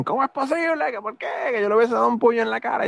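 very high, incredulous outburst; outraged friends. Retold or imitated. Friends react to his refusal. (amigos indignados, voz muy aguda)

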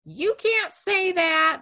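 high, loud, drawn-out mock complaint; outraged Karen. Imagined listener objecting to the podcast, mock Karen outrage. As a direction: whine it like an offended Karen. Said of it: "just Karen out on me".

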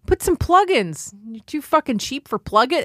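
high, exasperated mock rant at the boss; mock scolding. Mocking the stingy supervisor, high exasperated scolding.

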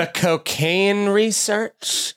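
slow drawn-out snarky repeat; hammed-up snarky echo; mock snarky. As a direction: imitate her snark, dragging it out. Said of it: "You wanna see snarky".

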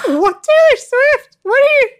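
high, squealed mock bewilderment; baffled disbelief. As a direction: squeak out the questions, utterly lost. Acting out her bewildered reaction.

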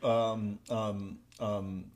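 usual speaking voice

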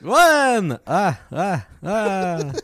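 high, booming vampire count with staccato laugh; hammed-up counting vampire; Count Hacula. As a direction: stretch the number, then cackle in beats. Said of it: "he's like".

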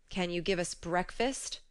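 plain reading voice

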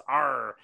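"R" said as a drawn pirate "arr"; mock the American R like a pirate's "arr"; exaggerated rhotic "R", pirate style. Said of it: "Americans, in large part, sound like pirates".

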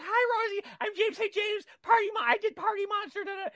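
eager high-pitched fan chatter; giddy fan greeting. Retold or imitated. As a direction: gush at a celebrity. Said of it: "I was like".

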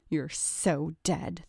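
slow, low-key menace; measured, understated threat; threatening. A narrator's reading. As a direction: threaten slowly under your breath. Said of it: "threatened John".

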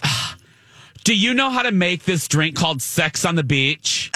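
high, breathy ditzy mock bride. Bride ordering a Sex on the Beach, high and ditzy. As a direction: ask it like nobody's heard of it. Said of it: "And she goes".

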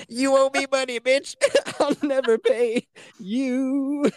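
squeaky mocking gangster; high pinched comic voice; high strangled voice. Choked small-person voice, high.

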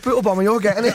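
loud mock threat; playfully aggressive. Fighter warning the host he is getting it, playfully aggressive. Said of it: "I'm getting aggressive".

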